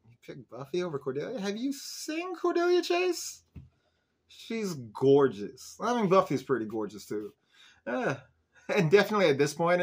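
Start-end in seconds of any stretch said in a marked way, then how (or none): 0.1-5.8 drawn-out disbelief, voice jumping high on "seen"; incredulous disbelief. Retold or imitated. Vlogger reacting to Owen choosing Buffy, slow incredulous disbelief. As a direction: stretch it out like you can't believe it. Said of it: "I'm just like".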